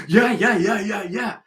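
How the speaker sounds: high, rapid string of agreement; mock eager agreement. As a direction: parrot their eager agreement, high and loud. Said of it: "And they'll go".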